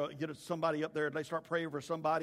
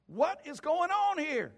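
high, slow, emphatic demand; loud demand; 14 semitones higher, 9 dB louder, slower than usual. Retold or imitated. Jesus confronting the arguing crowd, high slow demand. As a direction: demand an explanation from everyone, drawn out.

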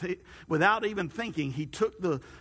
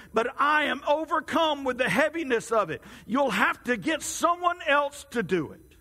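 raised, strained pleading voice, pitched higher; despairing complaint; 7 semitones higher than usual. A narrator's reading. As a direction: pour out his frustration to God. Man complains to God in despair.

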